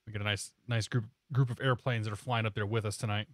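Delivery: usual speaking voice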